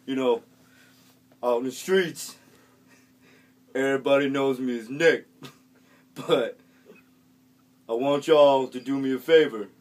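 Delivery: loud, deadpan street voice copying a share; earnest street kid. Retold or imitated. Kid copying the earlier share word for word, loud and earnest. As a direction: parrot the other guy's speech with a straight face.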